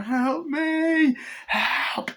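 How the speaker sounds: very high, desperate wail; hammed-up high plea; mock cry for help. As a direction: imitate a victim screaming for help. Victim screams for help.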